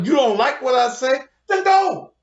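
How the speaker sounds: angry shout. Real, not acted. Furious rant at commenters.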